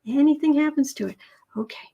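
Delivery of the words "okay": soft unvoiced whisper, a little laugh at the end; mock-scared whisper. Whispering while backing away from the tarot reading. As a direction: whisper as if sneaking away from danger. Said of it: "backing away slowly".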